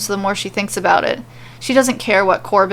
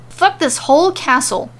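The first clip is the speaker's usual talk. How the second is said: high-pitched exasperated complaint; fed-up grievance, pitched up; complaining; 7 semitones higher than usual. A narrator's reading. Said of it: "she complains".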